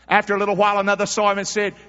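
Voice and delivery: loud, high preaching read; preaching scripture. Acted. Preacher reading Peter's denial to church, loud. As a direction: preach the passage with fire.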